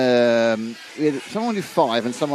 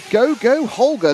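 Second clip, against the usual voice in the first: higher and louder, cheering Holger on; excited cheer; 9 semitones higher, 5 dB louder, slower than usual. Retold or imitated. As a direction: shout it like a fan urging him. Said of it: "says Daniel Gross".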